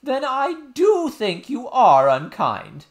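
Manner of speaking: high, loud, slow hurt nurse voice; wounded nurse. A narrator's reading. Nurse telling princess she is unkind, hurt. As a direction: whine that she's been wronged. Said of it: "said the nurse, put her handkerchief".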